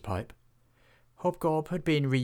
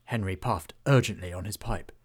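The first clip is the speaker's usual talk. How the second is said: ordinary delivery, nothing marked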